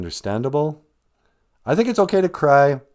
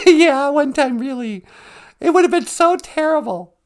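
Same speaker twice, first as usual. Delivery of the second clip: laughing; 14 semitones higher, 5 dB louder than usual. Real, not acted. Laughing recalling funny story.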